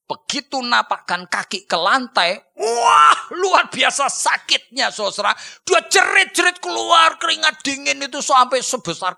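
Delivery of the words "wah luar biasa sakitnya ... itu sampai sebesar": loud high pained outcry; boy stepping on broken legs, shouting in pain; agonized cry. Acted. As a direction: cry out in pain as the leg takes weight. Boy's agony on first step.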